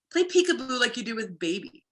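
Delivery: ordinary talk, nothing marked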